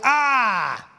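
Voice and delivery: sneering crowd jeer; vicious mocking jeer. Shown as a demonstration. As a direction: spit out "Ah!" like a cruel taunt. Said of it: "No, it, it was like".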